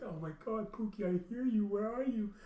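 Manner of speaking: high, urgent call to a pet; excited calling. Retold or imitated. Owner calling to his hidden cat, high excited voice. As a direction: call out hopefully, pitch climbing.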